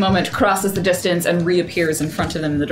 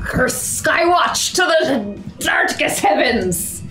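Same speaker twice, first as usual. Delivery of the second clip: slow, drawn-out pirate curse; pirate accent; 7 semitones higher, much slower than usual. Acted. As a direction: growl it like a stage pirate. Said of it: "everyone's now got this accent".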